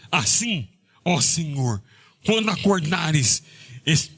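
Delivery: solemn reading of scripture. Acted. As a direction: read the psalm out with weight. Reads psalm aloud in sermon.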